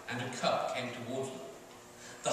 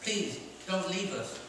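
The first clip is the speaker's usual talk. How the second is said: pleading cub; 6 semitones higher, slower than usual. Acted. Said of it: "the lion cub cried".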